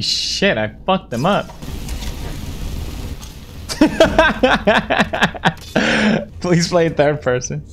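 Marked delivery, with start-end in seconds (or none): 1.5-6.4 breathy start rising into high cackling laughter; long laugh. Real, not acted. Player laughing after messy game fight, long laugh.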